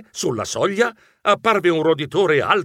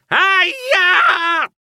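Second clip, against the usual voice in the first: very high, long yelp; yell of pain; 4 dB louder than usual. A narrator's reading. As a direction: yell as if your foot is stomped.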